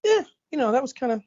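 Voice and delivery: mock lukewarm shrug. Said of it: "came out of the theater going".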